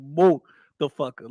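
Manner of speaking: mo the fucker, said as three split syllables; mocking pronunciation. Mimicking how the streamer said the word, split apart. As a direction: break it into mo, the, fucker. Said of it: "he said motherfucker, like".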